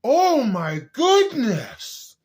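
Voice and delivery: hammed-up high, slow amazement; fake surprise. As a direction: overact tasting it for the first time. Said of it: "I'm a really good actor".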